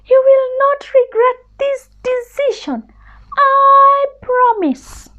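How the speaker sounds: a tiny scared mouse begging pardon; little mouse voice. Acted. Mouse promises.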